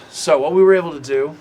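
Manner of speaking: put-on dwarf explains in an Australian accent he can't shake; a slipping Aussie twang on the dwarf. Dwarf smith explains the blade, Australian accent. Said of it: "I'm going Australian".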